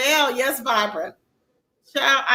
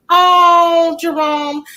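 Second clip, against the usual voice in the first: touched oh; 4 semitones higher, 6 dB louder, much slower than usual. Real, not acted. Touched by viewer's apology.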